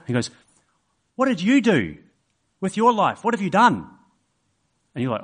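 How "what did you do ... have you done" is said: put-on higher, eager childlike questions; curious little boy. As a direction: quiz the old man like a kid. Child voiced in story.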